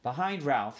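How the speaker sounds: ordinary delivery, nothing marked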